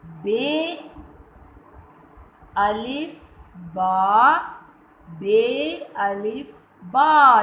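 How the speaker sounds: बे अलिफ बा spelled out slowly; slow reading drill. Shown as a demonstration. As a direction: read it slowly for children to follow. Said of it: "फिर से पढ़ेंगे". (बच्चों के साथ धीरे धीरे पढ़ना)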